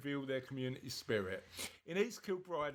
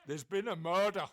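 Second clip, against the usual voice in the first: mock Scottish drama line; 3 semitones higher, 7 dB louder than usual. Jokey Scottish catchphrase after accent.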